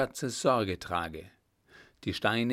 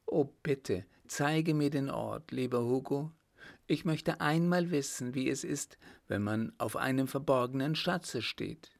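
higher, coaxing woman's voice; Margot, gently pleading; 3 semitones higher than usual. Acted. Woman begging her beloved to show the treasure, pleading. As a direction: plead lightly in a raised heroine's voice.

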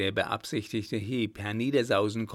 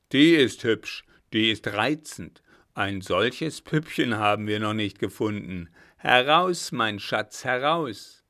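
loud, greedy leer; leering robber; 7 dB louder than usual. Acted. Robber lures Margot from the carriage, loud and leering. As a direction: coax her out with coarse glee.

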